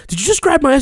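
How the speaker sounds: high, loud mock-indignant woman's voice; outraged girl. Girl accusing the guy beside her, in a high loud mock outrage. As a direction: play the offended girl, shrill with outrage.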